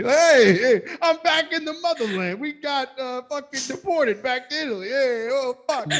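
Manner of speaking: high, wailing mock Italian-American lament; hammed-up crying as a deported Italian American.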